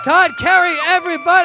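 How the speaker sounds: loud MC shout-out; big, projected stage announcement; applause call. Real, not acted. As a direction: announce the act to a cheering crowd. MC calls for applause after set.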